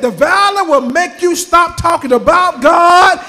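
loud, rising shouted preaching; preaching at full force. Acted. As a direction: drive it home to the congregation.

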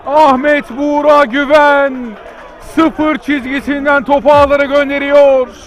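loud, high goal yell; screaming football commentator. Real, not acted. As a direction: shout the late winner. Late goal, commentator erupts.

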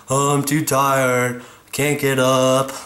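slow, drawn-out tired complaint; mock tired whining. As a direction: drag it out like you're too worn out. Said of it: "instead of just saying".

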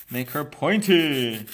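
loud, drawn-out playful cry; playful exclamation. Real, not acted. Host cheering on the nail she files, playful exclamation. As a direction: call it out big, stretching the words.

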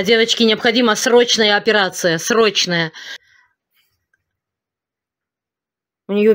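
loud, urgent plea for help; призыв о помощи. Real, not acted. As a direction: beg viewers for help urgently. (громкая горячая мольба о помощи)

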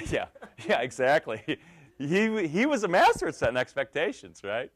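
loud laughing yes, yeah, exactly; laughing loud agreement. Real, not acted. To the host, laughing loudly in agreement. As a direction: belt it out between laughs.

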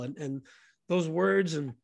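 ordinary talk, nothing marked

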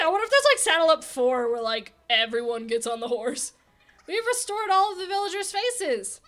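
usual speaking voice

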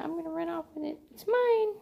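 voicing the cat running off with it; greedy cat voice. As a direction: squeal it like a thief cat. Voicing cat stealing her item.

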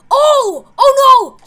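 loud, high-pitched shock; startled cry. Real, not acted. Player finds Chica gone, loud startled cry. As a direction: blurt it out in sudden alarm. Startled by missing game character.

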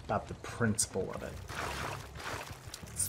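low, soft, quick grumble; hushed, low aside to yourself; quiet grumble. Real, not acted. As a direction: say it under your breath. Grumbles to self after escape.

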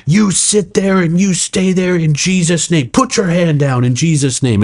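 high, drawn-out order with authority; retold frantic rebuke, pitched high; stern command. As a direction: command him like casting out demons. Retold frantic command to restrain man.

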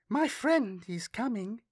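put-on high, slow and suddenly bright little girl; light up as you say it; suddenly brightening. A narrator's reading. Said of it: "suddenly brightening".

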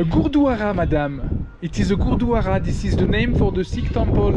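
put-on French accent with Gurdwara said gur-dwa-RA; mock French guide accent. French guide accent to a visitor. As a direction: play a fake French tour guide to the lady. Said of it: "I am your French guide".

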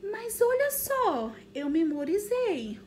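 high, loud, delighted; teacher boasting she remembered the cards; playful triumph. Pretend. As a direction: show off to the kids playfully.

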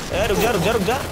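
high, loud repeated command; real mid-fight shout; urgent yelling. Real, not acted. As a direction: call out frantically to halt someone.

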